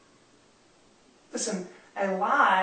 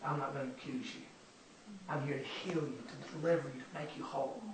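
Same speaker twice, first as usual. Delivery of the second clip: soft, hushed, tender promise; gentle reassurance; 5 semitones lower, 11 dB quieter than usual. Acted. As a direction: speak it softly, like comforting someone ashamed. Jesus comforting the woman.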